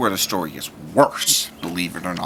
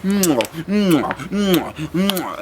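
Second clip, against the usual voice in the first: kissing sounds; 7 semitones higher, much slower than usual. Retold or imitated. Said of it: "I could hear".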